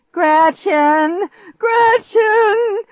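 very high, loud, stretched cries; frantic shout. A narrator's reading. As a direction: scream the name frantically. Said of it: "He sounded more frantic than ever".

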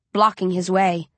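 usual speaking voice

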